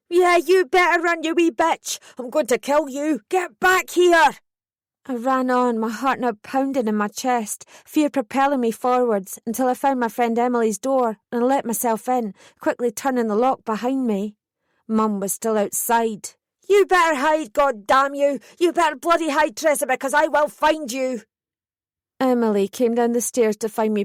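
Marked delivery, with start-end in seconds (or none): 0.1-4.3 high, loud furious yelling; screaming. A narrator's reading. Mum chasing her daughter down the street, furious high yelling. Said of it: "still screaming her head off". 16.7-21.2 shouting. A narrator's reading. Mum outside the friend's door, raging high shout. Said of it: "my mum's shout".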